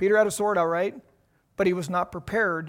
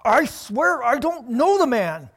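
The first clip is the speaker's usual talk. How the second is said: slow, louder, pressed sworn oath; intense sworn denial; 5 semitones higher, 7 dB louder, slower than usual. Acted. Peter cornered by enemies, swearing his denial. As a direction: swear it out, desperate and heated. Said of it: "Can you see the intensity here?".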